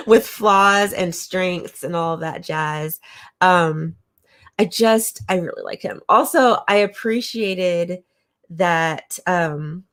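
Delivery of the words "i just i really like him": ordinary talk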